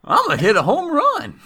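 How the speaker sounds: high, loud, slow hillbilly drawl; old prospector. Pretend. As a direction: boast like a grizzled old miner. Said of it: "Not great at the smack talk either".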